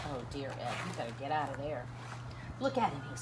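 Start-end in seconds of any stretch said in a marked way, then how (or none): none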